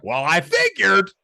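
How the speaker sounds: slow, smug shrug of a reply; unbothered, cocky; smug deadpan. Retold or imitated. Answering agent about cuffs, slow and smug. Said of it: "said it exactly like that".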